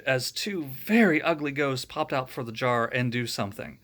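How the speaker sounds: usual speaking voice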